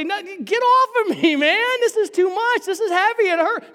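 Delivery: high, loud, strained complaint; hammed-up pleading to be let go; acted protest. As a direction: cry out as if buckling under a heavy weight. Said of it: "to say".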